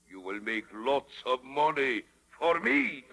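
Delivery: normal talking voice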